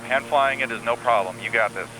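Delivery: ordinary talk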